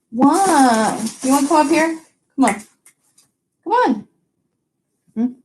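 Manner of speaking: high coaxing call; pet talk to dog. Real, not acted. Owner calling her dog up beside her, high coaxing. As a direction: invite the dog up sweetly.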